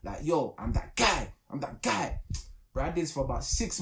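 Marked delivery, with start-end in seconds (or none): 0.2-2.2 mirror pep talk. Retold or imitated. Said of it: "I had to start talking in the mirror hardcore".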